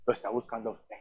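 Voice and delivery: very soft, low voice; hushed invitation. Shown as a demonstration. Preacher showing how to tell someone Jesus seeks them, hushed. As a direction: confide it almost under the breath.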